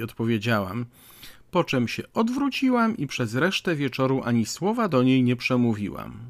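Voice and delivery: ordinary delivery, nothing marked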